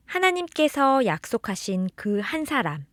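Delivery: plain reading voice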